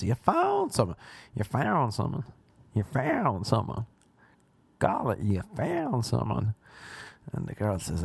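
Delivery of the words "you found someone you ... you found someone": put-on American accent. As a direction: mimic an older American man. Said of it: "he says in an American accent".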